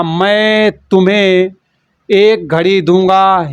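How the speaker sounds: very slow, each word spelled out for students; teacher models the right sentence slowly; careful correct form. Shown as a demonstration. Teacher correcting student's wrong sentence, slow and deliberate. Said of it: "तो यहाँ पर तेरे को नहीं होगा".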